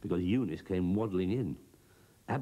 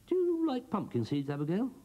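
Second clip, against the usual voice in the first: coaxing Broomstick; 3 dB louder than usual. Acted.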